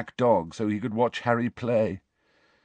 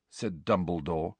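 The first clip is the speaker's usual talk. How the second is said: usual speaking voice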